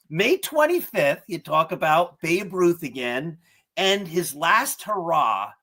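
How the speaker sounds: usual speaking voice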